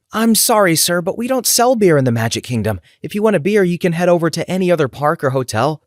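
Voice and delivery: high, sugary cast-member politeness; put-on perky apology; smiling customer service. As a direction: turn the guest down in a high, smiling voice. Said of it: "struggling to repeat myself with a smile".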